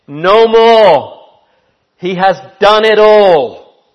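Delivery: loud, high ringing proclamation; triumphant loud preacher's cry; preaching at full force. Acted. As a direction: proclaim each word like a victory bell. Preaching climax on tetelestai.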